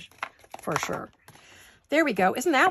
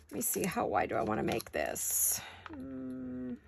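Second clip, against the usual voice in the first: whispered thinking aloud; whispering; 6 semitones higher, 8 dB quieter than usual. Real, not acted. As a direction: whisper while measuring. Measuring, thinking aloud to herself.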